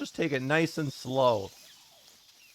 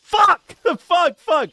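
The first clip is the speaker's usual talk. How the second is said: high, loud startled cursing; panicked swearing; 13 semitones higher, 12 dB louder, slower than usual. Real, not acted. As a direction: yelp out in panic. Caught by guard.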